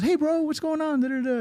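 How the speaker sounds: very high, cheery greeting; Brian. Retold or imitated. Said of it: "Brian's always ... great, good mood".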